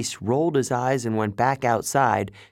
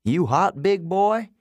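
higher taunting trucker voice; 9 semitones higher, 3 dB louder than usual. Acted. Trucker to the caged lion, taunting. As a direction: tease with a rising question. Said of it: "he asked the lion".

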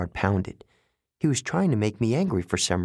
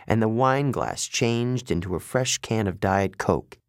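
ordinary delivery, nothing marked

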